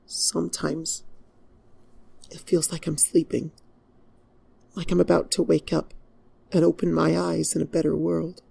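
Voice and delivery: high, fragile and distant; as if half asleep and longing; wistful weary princess; sad dreaming princess. Acted.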